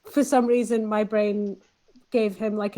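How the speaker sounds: normal talking voice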